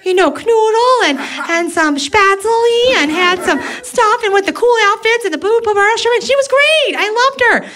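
high, sing-song Minnesota nice voice; Minnesotan. Retold or imitated. Minnesotan gushing fondly about a German grandma, high and sing-song. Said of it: "usually Minnesotans go".

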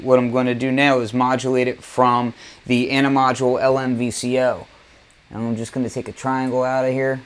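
ordinary talk

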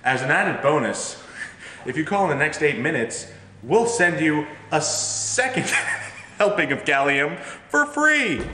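infomercial. Acted. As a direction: sell it like late-night TV. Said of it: "I was gonna say".

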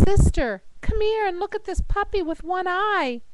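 mildly hammed-up beckoning; excited character calling out. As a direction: call your sister over. Joke character calls sister over.

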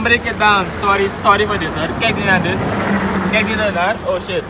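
normal talking voice

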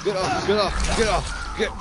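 high, loud frantic yell; panicked yelling. Real, not acted. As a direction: shout it in panic. Attacked, yelling at monster.

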